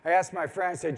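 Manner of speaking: ordinary delivery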